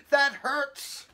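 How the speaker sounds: high, loud protest; loud pained complaint; yelled in pain. A narrator's reading. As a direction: yelp it like a hurt kid. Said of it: "yelled Haley".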